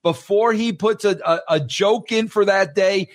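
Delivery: high, insistent exclamation; emphatic amazement. Real, not acted. Marvels at Seinfeld's daily discipline.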